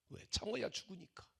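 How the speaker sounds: ordinary delivery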